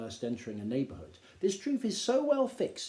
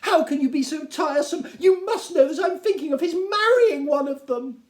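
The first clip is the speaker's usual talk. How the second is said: much higher and louder, whining; exasperated Mrs Bennet; 12 dB louder than usual. Acted. Wife complaining to her tiresome husband, high and whining. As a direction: scold him shrilly. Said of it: "replied his wife".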